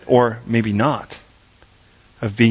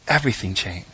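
quiet repeat; 6 semitones lower, 6 dB quieter than usual. Shown as a demonstration. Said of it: "repeated softer for emphasis".

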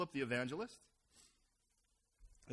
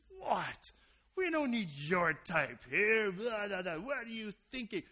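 disdainful local; 4 semitones higher, 6 dB louder, slower than usual. Retold or imitated. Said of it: "volcano of disdain erupts".

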